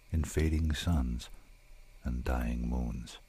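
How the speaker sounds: hushed, slow poem ending; fading poem close. Acted. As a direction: let the last line fade out. Closing line of the poem, fading.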